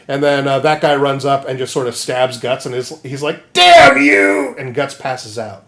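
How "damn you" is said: high, loud cry of rage; hammed-up curse. Guts stabbed by Griffith, loud cry. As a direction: spit the curse out.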